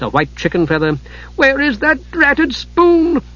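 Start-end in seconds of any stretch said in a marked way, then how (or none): 1.3-3.2 high, slow, fretful little voice; a high-voiced kender rummaging in frustration; frustrated kender. A narrator's reading. Tas hunting his pouches, high and frustrated. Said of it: "he cried in frustration".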